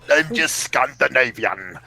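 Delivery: slow, badly faked Scandinavian accent; hammy mock-Scandinavian voice; horrible accent. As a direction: drag it out in a terrible Scandinavian accent. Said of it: "That was a horrible accent".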